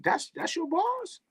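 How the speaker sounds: high, soft disbelief; quiet, high-pitched mock disbelief; incredulous. Real, not acted. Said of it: "I heard more profanity than words".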